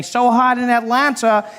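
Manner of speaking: high, slow on-the-scene report; hammed-up newscast voice; TV news reporter. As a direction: announce it like a live local news reporter. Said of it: "They would have their vans reporting live".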